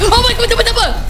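high alarmed exclamation; jolted by a sudden scare; real fright, voice jumps up; startled. Real, not acted. Jump scare reaction.

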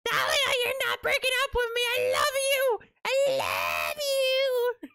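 high, screechy desperate plea; piercing, high-pitched cartoon begging; Toad. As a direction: squawk a lovesick cartoon yell, stretching the last cry. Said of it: "Toad yelling at me".

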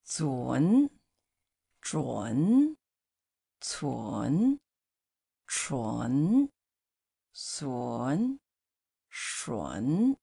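zun zhun cun chun sun shun, each clearly said; careful slow syllable drill for students; Mandarin syllable drill. Shown as a demonstration. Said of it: "Okay, one more time".

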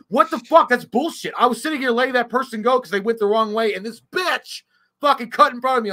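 high, heated rant; retold yelling. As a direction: imitate an outraged driver ranting loudly. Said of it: "Like, yelling".

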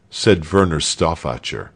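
plain reading voice

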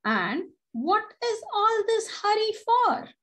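very high, teasing question; mock the rushing millipede; smug. A narrator's reading. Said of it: "she said smugly".

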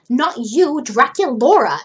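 loud, irritated snap; snapped. Acted. Cleo corrects Frankie, wanting Draculaura to pay, snapping. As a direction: snap it with emphatic swoops in pitch. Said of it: "she snapped".

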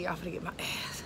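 hushed whisper to viewers. Real, not acted. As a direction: whisper it like a secret. Whispered aside before the pool.

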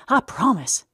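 put-on high, loud childlike promise; solemn earnest vow; eager promise. A narrator's reading. Eddie promising Tom he'll keep the secret.

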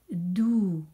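"dou" held long on its vowel; repeated dictation syllable. Shown as a demonstration. Teacher repeats the syllable for pupils writing, drawn-out "dou". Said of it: "Je redis".

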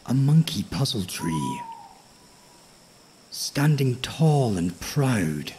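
ordinary delivery, nothing marked

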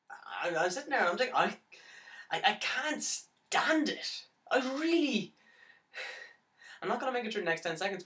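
high, strained outburst of despair; anguished, pitch climbing sharply; desperate. Acted. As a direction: break out as if unable to bear it. Despairing at being alone.

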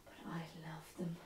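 very soft, low whisper; confiding whisper. Acted. Newcomer confiding love of the Irish, whispered. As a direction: drop to a hushed confession.

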